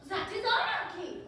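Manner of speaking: loud, high, indignant outburst; furious exclamation at the very idea; outraged shout. Acted. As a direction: bark it like a scandalised ruler. Character outraged at question.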